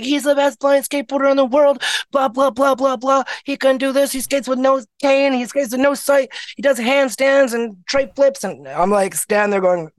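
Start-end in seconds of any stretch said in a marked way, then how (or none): 0.0-8.4 higher, hyped-up bragging; bragging friend. Retold or imitated. Friend Taylor boasting to the board sponsor, higher and hyped. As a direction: talk him up like a proud hype-woman.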